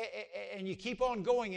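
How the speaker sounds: normal talking voice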